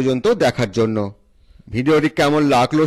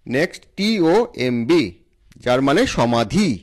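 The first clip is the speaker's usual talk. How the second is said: ordinary delivery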